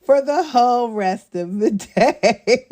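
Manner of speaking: slow, laughing delivery. Real, not acted. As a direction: crack up as you finish the line. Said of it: "I can't not laugh".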